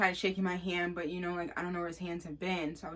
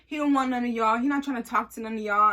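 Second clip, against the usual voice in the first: higher, louder smug insistence; smug insisting; 5 semitones higher, 6 dB louder than usual. Retold or imitated. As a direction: lay it on, sure of himself. Dude insists, mocking girls.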